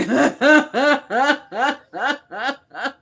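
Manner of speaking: big burst of real laughter, loud. Real, not acted. Host laughing at her own rambling to viewers. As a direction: crack up loudly at yourself.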